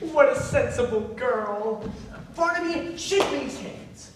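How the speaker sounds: ordinary talk, nothing marked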